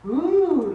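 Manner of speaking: high, loud "ooh" of the creeps; shivery ooh. Acted. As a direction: give a sudden creeped-out ooh. Imitating the creeps down the spine.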